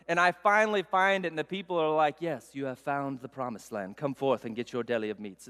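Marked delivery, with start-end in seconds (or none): 2.2-5.5 lower, softer, hushed solemn herald; grand lowered voice revealing the promised land of deli; mock heavenly proclamation. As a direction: announce it like an angel revealing paradise.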